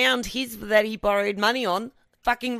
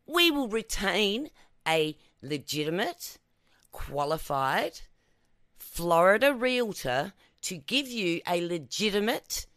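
usual speaking voice

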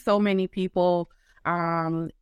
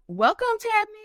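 excited welcome; 12 semitones higher than usual. Acted. Big announcer welcome.

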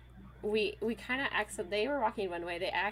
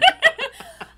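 loud, high laughing fit; burst of laughter; 18 dB louder than usual. Real, not acted. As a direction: crack up hard. Cracking up about the story.

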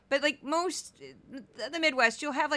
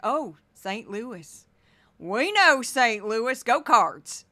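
slow, drawn-out Missouri drawl getting louder; local folks; 7 dB louder, much slower than usual. Retold or imitated. Cave-country locals cheering a Saint Louis family in a drawn Missouri accent. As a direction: play the friendly local, swelling to a cheer. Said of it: "And they're like".

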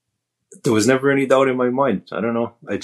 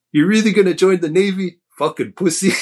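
higher-pitched sneering taunt; mocking Marine recruiter; 10 semitones higher, 3 dB louder than usual. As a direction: needle him like a cocky recruiter in the doorway. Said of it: "he goes".

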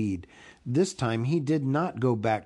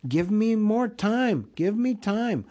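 held-back shout; 8 semitones higher than usual. A narrator's reading. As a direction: plead for time as a muted shout. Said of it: "He wanted to shout out".

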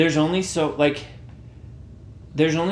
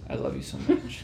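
tender; 12 semitones lower, 7 dB quieter than usual. Pretend. Mock love note to Taco Bell.

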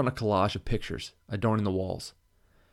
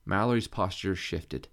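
ordinary delivery

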